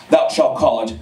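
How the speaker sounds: low, grave olde pronouncement; mock solemn prophet. Jerebdiah solemn low answer.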